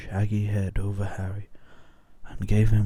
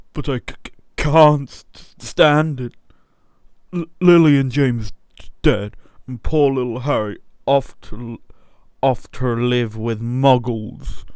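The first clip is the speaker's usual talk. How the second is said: higher, louder, stammering through tears; sobbing giant; 4 semitones higher, 9 dB louder than usual. Acted. Giant grieving the baby's dead parents, weeping and stammering. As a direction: blurt it out between sobs.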